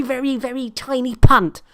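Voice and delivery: ordinary talk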